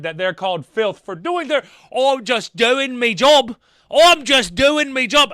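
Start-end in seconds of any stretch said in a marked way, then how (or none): 1.9-5.3 put-on English accent. Reactor mocks officers offended at being called filth, English accent. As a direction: put on a hammed-up English accent, higher and louder. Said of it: "I think I'm doing an English accent".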